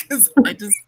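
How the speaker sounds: usual speaking voice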